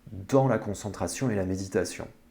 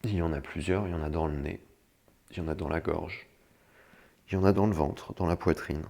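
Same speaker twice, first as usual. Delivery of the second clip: guided meditation; 4 semitones lower, 8 dB quieter than usual. Acted. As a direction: lead them gently into calm. (voix douce de méditation guidée)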